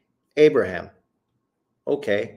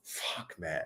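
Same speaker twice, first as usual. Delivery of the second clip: whispered curse; 6 semitones lower, 17 dB quieter than usual. Real, not acted. Fed-up aside after rant.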